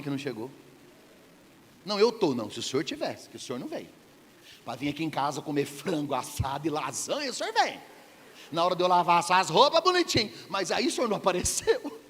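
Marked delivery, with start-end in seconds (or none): 1.9-12.0 a hammed-up Martha grumbling at Jesus; complaining Martha. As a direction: nag like an irritated housewife. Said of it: "Você viu o que ela disse".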